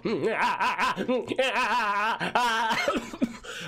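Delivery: real laugh at a friend's expense; high giggling laugh. Real, not acted. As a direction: let the laugh run high. Laughs at own intro.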